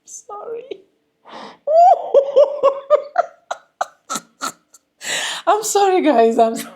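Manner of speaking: giggling through a sorry to viewers; apologizing to the guys while laughing; laughing apology. Real, not acted. Real laughter breaking through the apology. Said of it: "if I'm laughing so hard".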